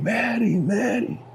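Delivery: high calling out of her name; yelling a name. Retold or imitated. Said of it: "I just started yelling".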